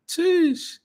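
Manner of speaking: squeaky high sheesh; a wowed, high-pitched sheesh; excited sheesh. Real, not acted. Reacting to a proverb.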